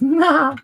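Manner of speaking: high, loud villain cackle; evil genius laugh. Pretend. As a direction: cackle like a mad scientist. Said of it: "That's a terrible laugh".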